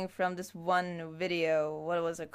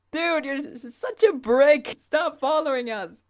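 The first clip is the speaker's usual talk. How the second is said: loud annoyed yell; shouting at someone; 4 semitones higher, 8 dB louder than usual. Acted. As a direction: yell it at the pest. Acted quarrel calling someone brick.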